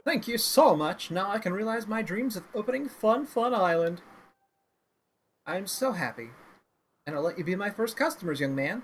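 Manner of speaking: loud, gleeful clown voice; a giddy carnival clown, overjoyed; silly clown. Acted.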